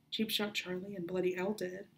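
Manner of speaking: plain reading voice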